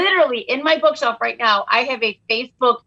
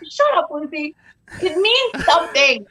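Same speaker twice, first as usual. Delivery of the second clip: higher, laughing mock-offended; mock mom retort; 6 semitones higher than usual. Mom teasing daughter Lucy, mock-offended. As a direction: playfully snap at your daughter. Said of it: "I was like".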